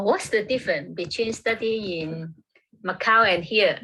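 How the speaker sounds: ordinary delivery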